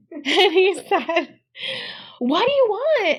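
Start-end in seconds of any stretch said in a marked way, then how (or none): 2.2-3.2 high, puzzled question; husband's reply. Retold or imitated. Husband answering his wife from outside, high and puzzled. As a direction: toss it back puzzled.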